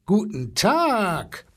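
slow, drawn-out elephant greeting; blustering elephant. A narrator's reading. Elephant greets the boy back, slow bluster. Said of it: "polterte der Elefant".

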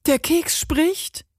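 high, slow disbelieving question; drawn-out, high-pitched shock; astonished. Acted. Lady hears a biscuit speak, high astonished question.

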